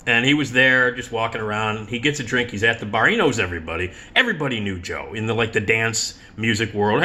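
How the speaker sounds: ordinary talk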